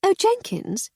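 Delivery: high, sing-song call of a lady; Lady Hardcastle calling the butler back; lady's call. Acted. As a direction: call him back, lilting and grand.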